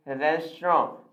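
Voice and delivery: "रेस्टोरेंट" as res-tro, silent final nt; preferred pronunciation. Shown as a demonstration. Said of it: "आप इसे आज से बोलेंगे". ("रेस्टोरेंट" को 'रेस्ट्रो' बोलें)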